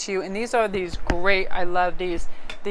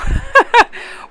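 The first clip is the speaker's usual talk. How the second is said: laugh; 8 dB louder than usual. Real, not acted. Laughing at her own joke.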